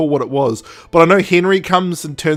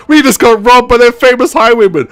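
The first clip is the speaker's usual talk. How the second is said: higher and louder, thrilled boast; really chuffed; 8 semitones higher, 6 dB louder than usual. Retold or imitated. Robbed nobles bragging to friends, thrilled. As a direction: brag like being robbed was a prize. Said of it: "really chuffed about it".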